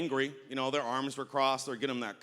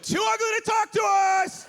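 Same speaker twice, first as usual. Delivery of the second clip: much higher and louder mock yell; shrill repeated shout; 7 dB louder than usual. Rude woman shouting the insult again at the comedian, high and loud. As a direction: bellow it back at him, pitched way up. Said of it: "she just repeated it".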